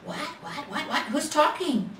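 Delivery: jolted awake and confused; startled. A narrator's reading. A toy wakes at the little bear's whisper, startled.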